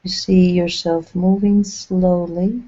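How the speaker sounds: measured, unhurried phrases, each left to settle; guided meditation. Acted. Leading the group along an imagined path in the clouds, guided meditation.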